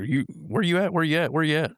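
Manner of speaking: high calling voice through the house. Retold or imitated. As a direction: call out to find him in the house.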